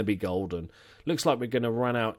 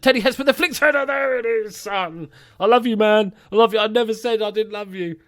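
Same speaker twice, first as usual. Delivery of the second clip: loud, high-pitched real elation; ecstatic goal celebration; 10 semitones higher, 7 dB louder than usual. Real, not acted. Gamer declaring love to his goal scorer, ecstatic shouting. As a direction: shout it as your striker scores.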